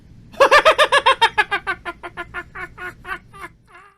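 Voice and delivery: a big, high, loud cackle to end the ghost story; loud spooky laugh. Acted. Narrator ending the scary tale for listeners, loud cackling laugh. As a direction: burst out laughing like a Halloween ghoul.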